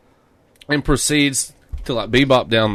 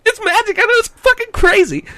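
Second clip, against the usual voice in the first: much higher, louder bro voice; hyped bro; 4 dB louder than usual. Retold or imitated. Imitating the clerk, high gushing bro voice. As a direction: gasp and gush like a fan.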